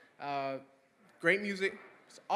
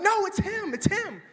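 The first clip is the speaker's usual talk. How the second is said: high and loud, pressing the point; high excited shout at the friends; excited insisting; 14 semitones higher, 7 dB louder, slower than usual. Retold or imitated. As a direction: yell it insistently.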